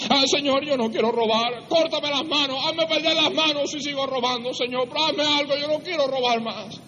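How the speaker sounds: high, wailing plea; acted desperate prayer. Preacher acting a thief begging the Lord, desperate. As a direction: beg God in a hammed-up high voice. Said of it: "a solas con el Señor así".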